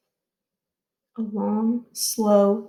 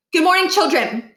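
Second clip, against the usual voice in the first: stern principal greeting; 7 semitones higher, 5 dB louder than usual. A narrator's reading. Principal addresses assembly.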